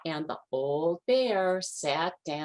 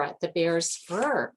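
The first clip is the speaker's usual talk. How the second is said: usual speaking voice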